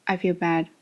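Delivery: deadpan, low monotone; flat intonation. Shown as a demonstration. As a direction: say it with no feeling at all. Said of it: "a flat intonation".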